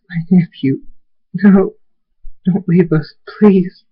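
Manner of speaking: high, distressed plea; tearful aunt. Acted. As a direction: beg your nephew to stay. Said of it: "Tears fall from".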